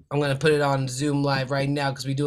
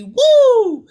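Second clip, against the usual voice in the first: very high, loud whoop; hype whoop; 13 dB louder than usual. Real, not acted. Hyping his salsa brand.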